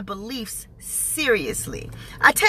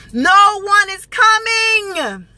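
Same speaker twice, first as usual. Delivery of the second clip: loud, drawn-out higher wail of frustration; fed-up, raised-voice complaint; exasperated cry; 7 semitones higher, 4 dB louder, much slower than usual. Retold or imitated. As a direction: stretch every word in exasperation. Exasperated at long wait.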